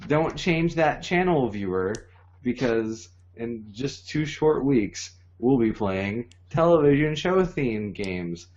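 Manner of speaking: slow promo announcer voice. Acted. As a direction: hype it like a TV ad.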